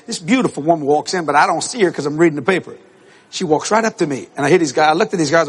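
normal talking voice

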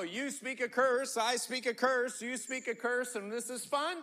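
ordinary delivery

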